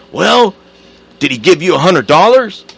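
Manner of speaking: loud demand. Retold or imitated. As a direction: shout the question at her. Ted demanding about the money.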